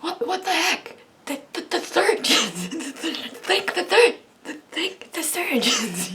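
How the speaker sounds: high, laughing protest; mock indignation. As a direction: sputter in playful outrage, tripping over words. Acting out indignation on surgeons' behalf.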